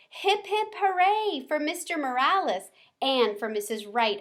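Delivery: high hamster cheer. A narrator's reading. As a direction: cheer brightly. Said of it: "I cheered".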